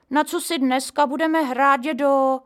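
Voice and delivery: Honzík pestering grandpa to play; impatient child. A narrator's reading. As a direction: nag impatiently. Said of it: "dorážel netrpělivě".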